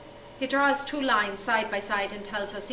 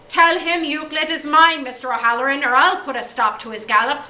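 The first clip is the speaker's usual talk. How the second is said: loud angry rant; 3 semitones higher, 9 dB louder than usual. Acted. O'Day rants at headmaster.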